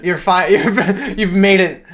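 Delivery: laughing through high congratulations; host congratulating guest, laughing. Real, not acted. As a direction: congratulate while cracking up.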